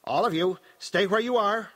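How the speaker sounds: loud, high command; parodied commanding control. A narrator's reading. Possessed daughter parodying her mother's voice of control, commanding. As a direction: order the whole room to freeze. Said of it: "a parody of Bene Gesserit control".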